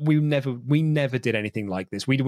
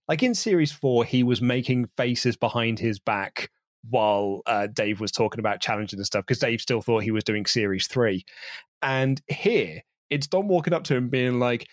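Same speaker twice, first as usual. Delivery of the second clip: ordinary talk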